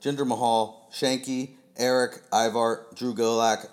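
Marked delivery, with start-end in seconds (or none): none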